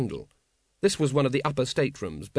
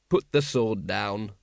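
low, slow, deliberate order; steady, lowered command; firm policeman; 4 semitones lower, slower than usual. Acted. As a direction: order him down without raising your voice. Vimes orders Wonse to disarm.

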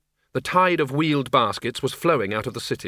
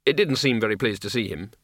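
ordinary delivery, nothing marked

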